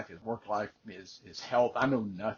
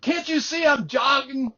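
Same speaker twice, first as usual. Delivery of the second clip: much higher, louder indignant retort; indignant jogger; 13 semitones higher, 7 dB louder than usual. Retold or imitated. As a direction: fire it back like an offended jogger. Angry jogger scolding the driver.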